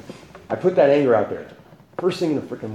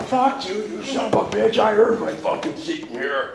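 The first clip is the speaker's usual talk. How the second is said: imitating angry yell; 10 semitones higher than usual. Angry stranger claiming his seat at the meeting, higher mock yell. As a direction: ham up the ranting guy defending his seat. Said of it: "he's yelling at everybody going in".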